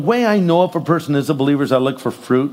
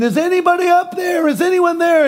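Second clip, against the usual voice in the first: desperate yell; 13 semitones higher than usual. Retold or imitated. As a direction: call up into the sky, panicking. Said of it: "And he's yelling".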